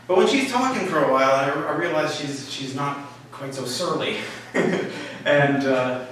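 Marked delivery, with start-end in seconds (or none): none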